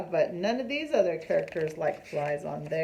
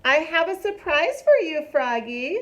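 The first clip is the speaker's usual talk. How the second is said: Frogalina; 8 semitones higher, 7 dB louder than usual. Acted. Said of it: "said Frogalina".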